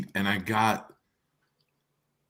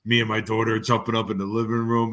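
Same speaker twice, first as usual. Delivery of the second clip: ordinary talk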